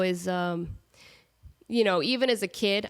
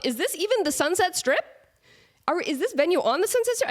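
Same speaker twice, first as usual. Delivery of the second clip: mock fussy question; 10 semitones higher, 4 dB louder than usual. Mocking her own fussy questions.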